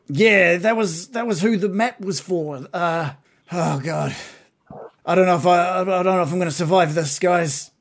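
slightly high, anxious dwarf; worried dwarf voice. Acted. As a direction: fret like a shaken survivor.

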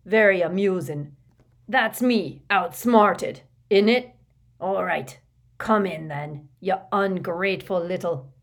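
grumbling, sarcastic growl; grudging, irritated outburst; Hagrid. A narrator's reading. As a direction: grumble loudly while giving in. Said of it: "growled Hagrid".

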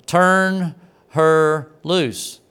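mock scream, each word drawn out very slowly; retold scream. Preacher ordering the women to let go of her, mock scream. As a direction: stretch out every word as a forceful, half-held-back shout. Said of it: "now he screams at them".

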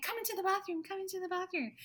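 high, insistent, repeated summons; urgent excited call. Retold or imitated. Wife urging her husband into the bathroom, excited. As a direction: hurry him along, breathless with news.

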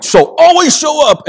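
high, loud exasperated order; angry outburst. Retold or imitated. Dad laying down the rule to his sons, loud and high. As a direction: bark it at the boys. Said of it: "And I'm like".

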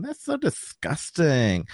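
slow, high-pitched mock-disgusted girl; Millie. Girl recoils from the bully who wet himself, hammed-up disgust. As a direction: draw it out like a grossed-out teenage girl. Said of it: "I don't kinda remember that voice".

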